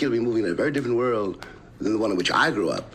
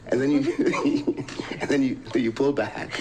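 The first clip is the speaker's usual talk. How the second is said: laughing. Real, not acted. Laughing while telling the story.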